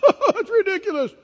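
high, laughing scoff; mocking laugh. Preacher playing the mockers, high and scoffing. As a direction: laugh it off as absurd. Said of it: "they mocked".

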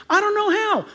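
high mock excuse; hammed-up helpless protest; protesting objection. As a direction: plead ignorance in a high voice. Acting an objecting listener.

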